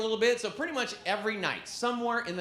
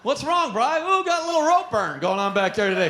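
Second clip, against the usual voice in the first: higher, louder mock buddy voice; teasing friend; 6 semitones higher, 6 dB louder than usual. Friend ribbing Bri about a rope burn, louder higher voice. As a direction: rib him playfully.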